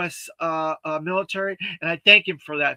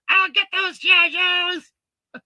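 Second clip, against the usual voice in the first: high, slow, hissing cartoon villain threat; Cobra Commander voice; 12 semitones higher, 4 dB louder, slower than usual. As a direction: sneer it like a toy-cartoon supervillain. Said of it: "the Cobra Commander accent".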